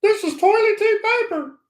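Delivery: high squeaky toilet paper puppet voice. Retold or imitated. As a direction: put on a high cartoon puppet voice. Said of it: "he kinda talks like this".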